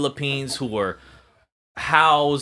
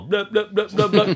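high, louder mock-language babble; mock foreign speech; 9 semitones higher, 4 dB louder than usual. Pretending to meet a Rwandan and mock his language, mock babble. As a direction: pretend to imitate a language in gibberish. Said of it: "And I go".

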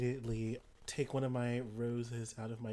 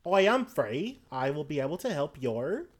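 loud in-character line; 3 semitones higher, 9 dB louder than usual. Acted. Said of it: "and I say".

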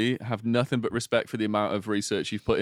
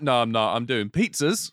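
ordinary delivery

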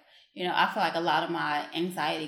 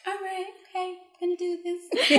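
small, much higher voice; meek acted reply; 13 semitones higher, faster than usual. Acting out her meek reply.

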